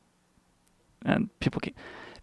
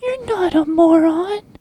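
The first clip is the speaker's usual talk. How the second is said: mock reassuring friend. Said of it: "they're like".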